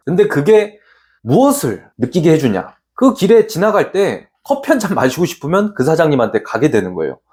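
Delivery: ordinary talk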